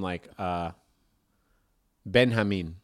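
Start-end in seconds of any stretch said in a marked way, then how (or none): 2.0-2.8 Benjamin said the Spanish way as ben-HA-meen; Spanish h sound in Benjamin. Shown as a demonstration. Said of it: "someone might be named".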